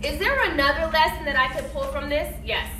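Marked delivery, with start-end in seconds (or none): none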